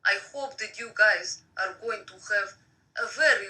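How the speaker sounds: ordinary delivery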